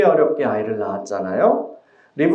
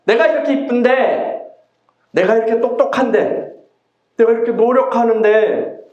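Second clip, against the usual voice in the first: frustrated Rachel; 8 semitones higher than usual. Acted. Rachel complains she cannot conceive.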